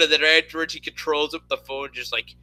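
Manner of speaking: ordinary talk, nothing marked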